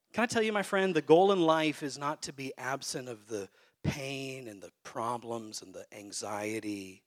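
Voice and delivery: normal talking voice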